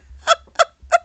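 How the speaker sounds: high, loud staccato laughter; cackling laugh. Real, not acted. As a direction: crack up in short bursts. Cracks up at eager mom.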